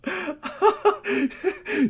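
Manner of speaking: high disbelieving laugh. Real, not acted. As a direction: laugh off the blunder.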